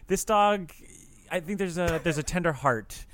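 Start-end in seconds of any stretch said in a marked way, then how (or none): none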